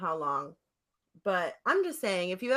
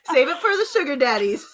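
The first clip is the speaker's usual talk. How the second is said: high, loud, fast sassy comeback; sassy retort; 5 semitones higher, 8 dB louder, faster than usual. Real, not acted.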